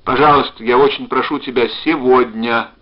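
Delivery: loud, urgent begging; shouted plea. A narrator's reading. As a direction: plead hard down the phone, building to a cry. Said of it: "закричал он".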